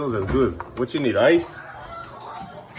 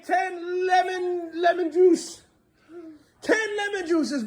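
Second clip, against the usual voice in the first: high playful singsong; much slower than usual. Pretend. Playful counting game with child.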